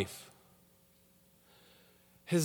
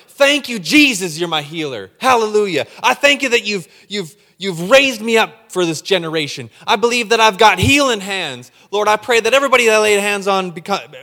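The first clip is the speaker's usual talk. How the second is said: loud, high, fervent hallelujah prayer; shouting praise to Jesus; 5 semitones higher, 9 dB louder than usual. Acted. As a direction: pray it out loud with full fire. Acting believer's fervent prayer.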